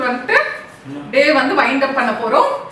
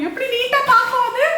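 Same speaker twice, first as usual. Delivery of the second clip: very high singsong parent voice; high-pitched, teasing questions to a little one; 11 semitones higher than usual. Real, not acted. Parent playing animals with toddler.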